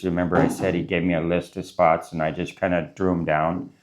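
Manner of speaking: ordinary talk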